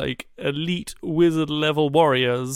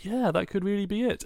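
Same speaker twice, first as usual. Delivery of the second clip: a high, softer, wide-eyed oh yeah; mock convinced; 8 semitones higher, 3 dB quieter than usual. Reading the Jar Jar Sith theory, high mock-convinced voice. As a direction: play the gullible reader swept up by the theory. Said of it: "you go".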